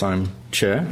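ordinary talk, nothing marked

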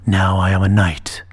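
low hushed whisper; whispered dragon. A narrator's reading. Dragon whispering his new identity.

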